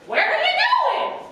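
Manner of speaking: very high, loud bird voice; high screechy albatross. A narrator's reading. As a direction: call down from the sky. Albatross voice in story.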